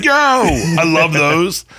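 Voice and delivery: high, slow, drawn-out bro voice; mock dude voice. Comic answering a fan from a passing car, slow high bro voice. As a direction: stretch it out like an excited bro. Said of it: "And I'm like".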